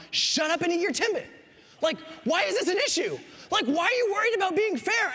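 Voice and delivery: high-pitched, exasperated inner rant; exasperated parent. Retold or imitated. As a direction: vent at a fussy child in mock outrage. Parent's unspoken rant at son.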